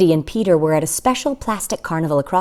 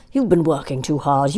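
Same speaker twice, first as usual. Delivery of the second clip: ordinary delivery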